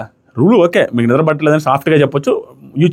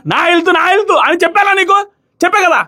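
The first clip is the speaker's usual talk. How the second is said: mock yell; 13 semitones higher than usual. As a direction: act out her shout without real volume. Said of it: "అనేసి పెద్దగా అరవగానే".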